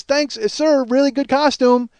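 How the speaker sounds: high, eager compliment; cheery wife. Retold or imitated. Wife compliments the old man at the elevator, high and eager. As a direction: chirp it brightly at him. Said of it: "She goes".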